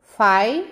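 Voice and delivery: "fai-xa" with a long pause after fai; split into syllables. Shown as a demonstration. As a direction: fai lifted, then a separate xa. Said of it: "Se a gente fizer a separação silábica".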